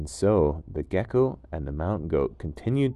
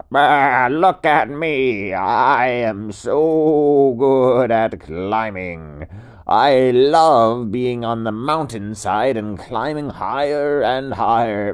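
loud, higher, slow proud goat voice; boastful goat; 4 semitones higher, 10 dB louder, slower than usual. Acted. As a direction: puff up like a show-off goat on a cliff. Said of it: "And the goat said".

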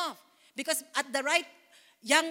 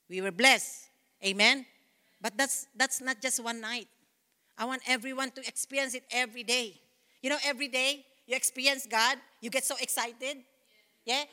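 ordinary delivery

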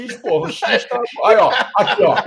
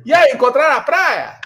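higher, louder teasing call; mocking taunt; 5 semitones higher, 4 dB louder than usual. Kiosk guy to returning tourists, mocking call. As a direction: taunt them like a prankster enjoying the trick. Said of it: "olhou pra gente rachando o bico e falou assim".

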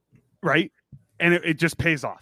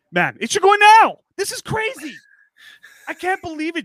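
excited; 14 semitones higher, 6 dB louder than usual. Real, not acted. Excited after Cup Final win.

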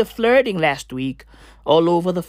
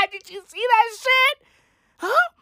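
incredulous; 13 semitones higher than usual. Real, not acted. Incredulous question to viewers.

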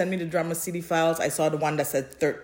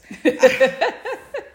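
loud high laugh; 9 semitones higher, 7 dB louder than usual. Real, not acted. Laughing at ending.

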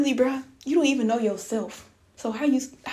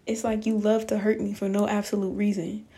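usual speaking voice

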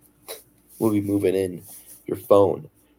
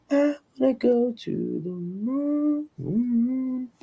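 high, slow, dreamy wail; much slower than usual. Pretend. Brand taking off to the moon.

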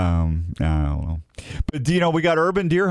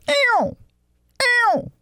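very high, strained squealing bugle; elk bugle; 3 dB louder than usual. Retold or imitated. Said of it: "I can't bugle".

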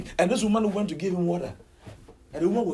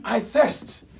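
raised, loud cry of thirst; acted scream; 7 semitones higher, 6 dB louder than usual. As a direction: call it out high and loud. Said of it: "And then he screamed".

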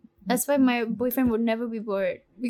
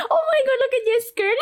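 shrieking laughter; 13 semitones higher than usual. Real, not acted. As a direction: squeal it through laughter.

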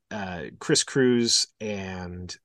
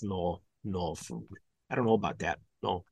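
low, much softer grumble; muttered refusal; 6 semitones lower, 10 dB quieter than usual. Acted. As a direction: trail into muttering to yourself. Muttered tail of accented refusal.

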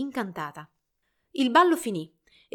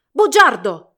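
very loud, raised accusing shout; angry yell; 4 semitones higher, 16 dB louder, slower than usual. A narrator's reading. Rival storming through crowd at Albert, yelling. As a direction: burst out with the accusation. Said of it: "urlò Hilarion".

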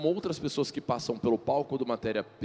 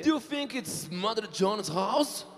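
high, drawn-out careful English; slow, high English; 8 semitones higher, much slower than usual. Shown as a demonstration. Host reads a riddle phrase slowly and high to [name]. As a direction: lift the voice and read slowly. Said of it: "Do you think, você acha o quê?".

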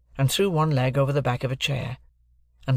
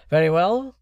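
raised, deliberate lawyer's voice; stern lawyer; 5 semitones higher, 5 dB louder than usual. Acted. Jaggers introducing himself to Joe and Pip, stern lawyer. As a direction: announce yourself like a cool London lawyer.

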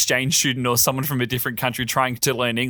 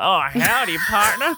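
playful higher cowboy greeting; Toy Story cowboy; 6 semitones higher than usual. Retold or imitated. Pal mimics teasing colleagues' hat tip, cowboy impression. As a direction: tip your hat with a mock cowboy howdy. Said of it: "the old Toy Story hat tip".

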